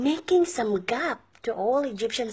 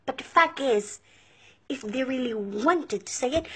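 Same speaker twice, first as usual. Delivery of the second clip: usual speaking voice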